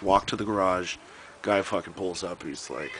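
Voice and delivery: ordinary talk, nothing marked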